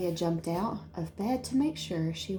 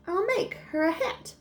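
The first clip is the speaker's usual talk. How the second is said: much higher, delighted; excited fairy; 12 semitones higher than usual. Acted. Fairy delights Jaya with her hat idea, high and excited. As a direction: squeal out the idea.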